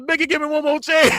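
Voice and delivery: loud, very high comic plea; mock pleading. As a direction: beg loudly in a high put-on voice. Laughing imitation of Greg pleading.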